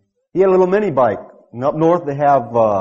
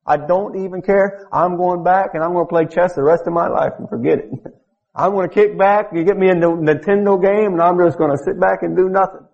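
mock fed-up prayer; 6 semitones higher than usual. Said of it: "And you said".

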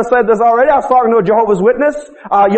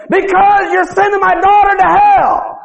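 high, drawn-out angry retort, acted out; getting mad; 8 semitones higher, slower than usual. Preacher acting out an angry father confronting his visitors, mad. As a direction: imitate an angry father's slow, high-pitched outburst. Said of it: "he was just getting mad".

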